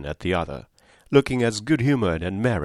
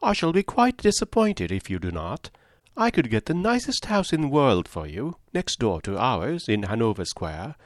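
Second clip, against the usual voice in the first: chattering Mrs Palmer; 2 semitones higher than usual. Acted. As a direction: prattle on brightly without pausing. Mrs Palmer urges them.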